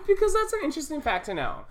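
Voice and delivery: high, fast mock-excited answer; mock excitement. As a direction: gush it in a hammed-up way. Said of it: "she looked excited".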